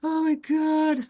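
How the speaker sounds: loud, high, drawn-out mock wailing; acted sad wail. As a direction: let the sadness pour out in a slow, hammed-up wail. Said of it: "okay, if I'm sad".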